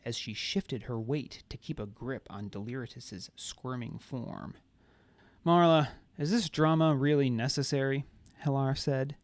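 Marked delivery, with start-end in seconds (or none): 5.5-8.0 bored drone. A narrator's reading. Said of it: "typical disinterested drone".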